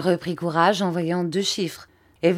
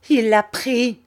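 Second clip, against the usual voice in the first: raised, higher-pitched outcry; alarmed cry; 6 semitones higher, 5 dB louder, slower than usual. A narrator's reading. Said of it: "criait Miss Murdstone". (cri d'alarme indigné)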